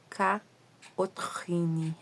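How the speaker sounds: Caotrine in a French accent, very slow. Shown as a demonstration. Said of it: "mania de falar com sotaque francês".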